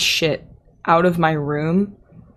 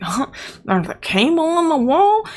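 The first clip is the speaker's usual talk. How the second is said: high, incredulous echo of "There's a cable in the wall?"; mock disbelief; 10 semitones higher than usual. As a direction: throw her words back in mock shock. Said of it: "I'm like".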